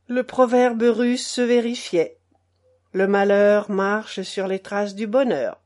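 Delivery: ordinary delivery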